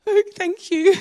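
high, loud, overly polite thanks; polite teen. Retold or imitated. Teen thanking her youth leader, high and clueless. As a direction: thank him without a clue. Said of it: "I'm just like".

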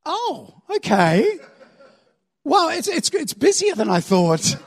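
slow, high, hesitant "oh"; awkward letdown. Acted. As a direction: stall politely as the excitement dies. Excitement dies at the sight.

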